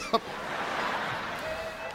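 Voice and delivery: breathless, voiceless laugh fit; silent wheezing laugh. Real, not acted. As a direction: laugh without voice, trying to hold it in. Laughing at her fall.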